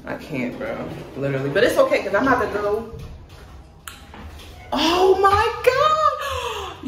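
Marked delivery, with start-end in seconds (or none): 4.7-6.8 long, drawn-out "Oh my God!" pitched way up and loud; a shocked cry, stretching every word; stunned shriek. Real, not acted. Girl realizing she forgot her tripod, shrieks in shock.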